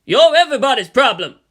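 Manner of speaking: loud furious shout; screamed back. Acted. The president screaming back at Misato, loud shout. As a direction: blast it back at her. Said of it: "the president screamed back".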